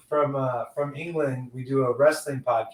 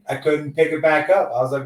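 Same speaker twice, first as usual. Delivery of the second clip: normal talking voice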